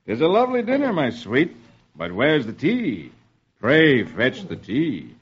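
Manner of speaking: slow, courtly dinner-table request; lightly voiced husband; courteous colonial husband. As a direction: ask politely, drawing the words out.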